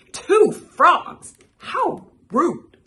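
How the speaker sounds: high, loud indignant echo; offended. A narrator's reading. Storybook character insulted by the fly, high and loudly offended. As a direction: cry out as if insulted.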